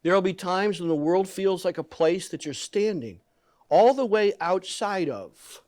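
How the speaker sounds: ordinary delivery, nothing marked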